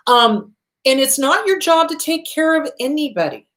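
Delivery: ordinary delivery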